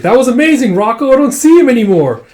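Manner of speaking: high, loud amazed praise; thrilled. Acted. Erica praising Rocco after the escape. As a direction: gush like a thrilled girl.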